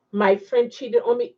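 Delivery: ordinary talk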